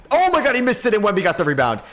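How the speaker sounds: high, loud burst of joy; excited yell. Real, not acted. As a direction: shout as luck turns your way. Opponent misses, lucky rebound.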